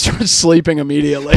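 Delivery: ordinary talk, nothing marked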